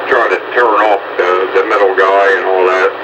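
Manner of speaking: usual speaking voice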